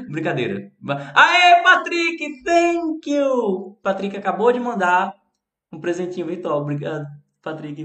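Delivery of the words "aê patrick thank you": loud, high, drawn-out cheer; excited greeting. Real, not acted. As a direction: cheer for Patrick's gift. Thanking a viewer's gift.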